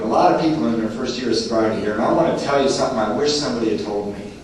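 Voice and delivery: usual speaking voice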